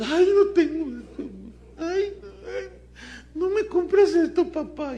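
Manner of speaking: put-on high, whining child voice near tears; whiny spoiled child. Preacher mimics a spoiled kid begging his father.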